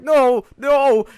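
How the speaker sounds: high, loud mock wail; imitated crying brother. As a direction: cry out like an upset kid. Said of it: "my brother crying".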